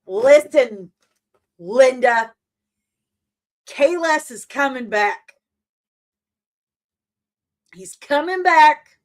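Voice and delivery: loud, insistent warning; loud rant. Real, not acted. Fan warning Linda about Kahless, loud and insistent. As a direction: hammer the point home to Linda. Ranting at an imagined friend.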